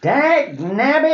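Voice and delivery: put-on high, slow cranky old-timer curse; old prospector. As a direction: spit it out like a grumpy old miner.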